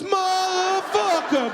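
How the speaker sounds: very high, drawn-out mock scream; outraged scream. Host erupts as costume head comes off, outraged scream. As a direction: stretch the curse into a high shriek.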